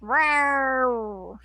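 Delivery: drawn-out mock cat roar; cat-like growl. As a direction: playfully yowl like an angry cat. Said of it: "It sounded like a cat".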